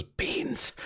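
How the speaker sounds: hushed, voiceless reply; soft whispered one-word answer. Acted. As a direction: whisper it secretively.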